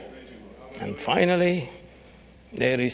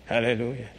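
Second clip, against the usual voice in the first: ordinary talk, nothing marked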